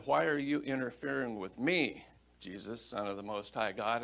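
ordinary talk, nothing marked

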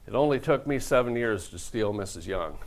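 normal talking voice

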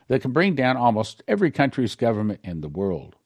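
ordinary talk

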